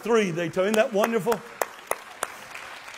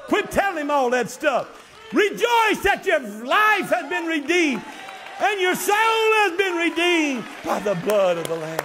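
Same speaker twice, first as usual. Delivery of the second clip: full-force preaching; 7 semitones higher than usual. Acted.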